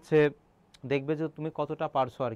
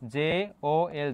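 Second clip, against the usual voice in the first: slow spelling; much slower than usual. Shown as a demonstration. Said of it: "দেখো তো".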